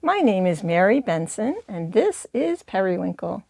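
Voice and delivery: ordinary talk, nothing marked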